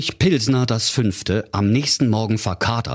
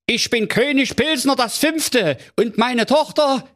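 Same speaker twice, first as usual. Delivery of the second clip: very high, flustered comic king; a panicking king shouting orders; king losing his head over his missing daughter, high and agitated. Acted. King introduces himself.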